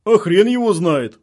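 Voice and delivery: slow, loud soldiers' yell; yelled reply. A narrator's reading. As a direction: yell it back from the checkpoint, drawn out. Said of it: "заорали бойцы с блокпоста".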